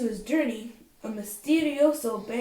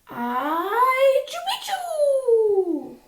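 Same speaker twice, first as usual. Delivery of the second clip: much higher, slow sneeze-shout of the password; sneezed word; 13 semitones higher, 6 dB louder, much slower than usual. Acted. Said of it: "half sneezed, half spoke".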